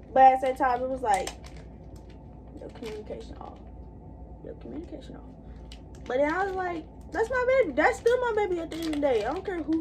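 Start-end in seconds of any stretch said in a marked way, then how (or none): 2.6-5.2 muttered aside. Real, not acted. Repeated line, muttered.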